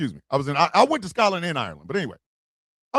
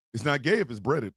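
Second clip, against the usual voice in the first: low tagline; 7 semitones lower, 6 dB quieter than usual. Pretend. Repeating the Pride tagline, low and soft. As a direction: lower and softer slogan.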